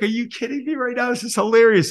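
high amused disbelief. Retold or imitated. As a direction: marvel at the scene from the corner. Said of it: "I'm in the corner".

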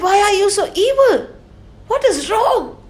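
high, fast outraged demand; indignant king. Acted. King Parikshit demanding answers from Kali, high and outraged.

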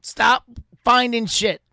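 loud, drawn-out order, word by word; emphatic mock command. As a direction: lay down the law slowly and loudly.

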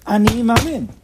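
loud, high, bold declaration; ringing, forceful affirmation; no-questions conviction. Shown as a demonstration. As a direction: proclaim it with total certainty. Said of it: "There's no questions".

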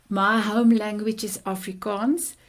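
ordinary talk

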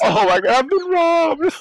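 loud, high mock wail; mock alarm. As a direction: cry out in hammed-up panic. Re-enacting his panic over missing rings.

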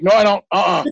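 mildly defiant, mock-stubborn. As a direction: dig in and refuse outright. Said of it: "I just was so defiant".

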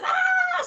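very high, held-back squeal; playful high shriek at moderate volume; mock scream. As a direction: imitate someone teasing with a scream. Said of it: "So she started screaming".

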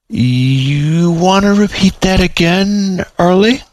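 slow, high disbelief; stunned. Acted. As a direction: make him say it again. Stunned at the price.

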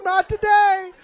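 hammed-up fierce warning; fierce defiance. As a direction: hurl it at them sharply. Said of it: "I'm talking to you too".

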